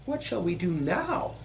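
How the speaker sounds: high-pitched little billy goat. A narrator's reading. Little goat asking his brothers, high small voice.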